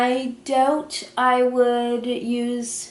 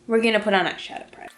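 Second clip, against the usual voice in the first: rattled off fast as an afterthought; rushed; much faster than usual. Real, not acted. Rewinding her makeup tutorial to viewers, rushed and quick. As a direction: hurry through it, catching herself. Rewinding to forgotten primer step.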